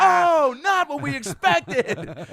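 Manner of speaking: very high, slow, loud fussy tone; mock prim voice. Mocking the crowd's reaction, very high and slow. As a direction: play a shocked prissy onlooker. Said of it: "And you go".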